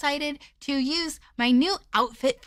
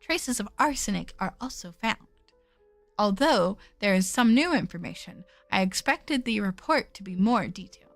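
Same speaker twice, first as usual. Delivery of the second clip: low, even narration voice; story narration; 5 semitones lower than usual. Acted. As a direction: read the game text calmly. Reads game narration quietly.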